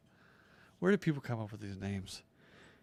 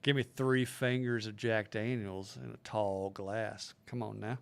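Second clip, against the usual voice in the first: Appalachian accent; 2 semitones lower, 5 dB quieter than usual. Shown as a demonstration. Showing friends the accent on demand, Appalachian accent. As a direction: order a drink like an Appalachian regular. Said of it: "I can turn on the Appalachian real quick".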